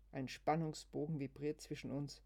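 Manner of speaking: plain reading voice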